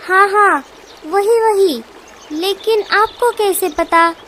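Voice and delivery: eager surprise. Acted. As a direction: blurts it out, amazed she knows.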